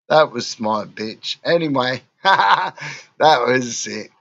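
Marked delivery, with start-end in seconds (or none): none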